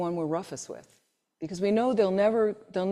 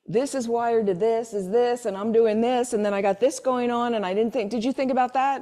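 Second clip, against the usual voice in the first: mock rambling woman; 4 semitones higher, 5 dB louder than usual. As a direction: play a woman listing all her wired-up thoughts. Speaker imitates a woman's rambling thoughts.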